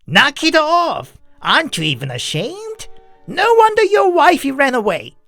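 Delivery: high, heated scolding; blunt rebuke. A narrator's reading. Temperamental neighbor shames the drunk, blunt and angry. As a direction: tear into him without tact. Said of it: "said more bluntly".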